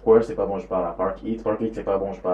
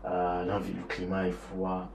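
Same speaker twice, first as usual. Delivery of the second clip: usual speaking voice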